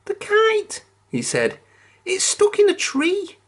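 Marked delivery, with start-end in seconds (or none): 0.1-0.8 very high, plaintive piggy voice; Pig upset. A narrator's reading. Pig telling friends his kite is stuck, high and plaintive. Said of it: "He looked upset". 2.0-3.4 very high, plaintive piggy voice; Pig upset. A narrator's reading. Pig telling friends his kite is stuck, high and plaintive. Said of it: "He looked upset".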